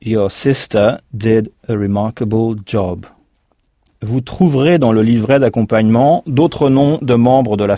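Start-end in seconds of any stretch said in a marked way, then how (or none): 0.0-3.1 "Your sister did a remarkable job." said slowly, word by word; slow repeat for learners. Shown as a demonstration. Teacher repeats the model sentence slowly. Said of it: "comment dira-t-on".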